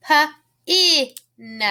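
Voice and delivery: "P-I-N" as puh, ih, nuh; sounded out. Shown as a demonstration. Teacher pointing out the vowel, "P-I-N" sounded puh, ih, nuh. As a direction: say "P-I-N" by its three separate sounds. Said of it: "Makes that I sound".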